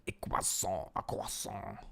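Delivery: breathy whispered "croissant" with a French ending. Shown as a demonstration. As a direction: whisper "croissant" as "kwa-son", the final n swallowed. Playing with French pronunciation of croissant.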